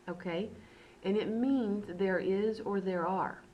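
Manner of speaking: normal talking voice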